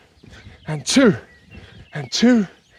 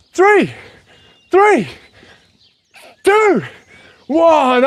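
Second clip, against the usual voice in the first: shouted countdown; 5 semitones higher, much slower than usual. Real, not acted. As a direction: count them down with rising energy. Countdown at workout's end.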